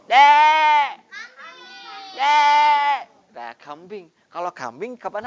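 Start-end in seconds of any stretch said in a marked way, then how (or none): 0.1-3.0 loud, high imitated goat cry; goat bleat. Shown as a demonstration. Teacher imitates a goat for a sound illustration, loud and high. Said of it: "Suara yang seperti ini".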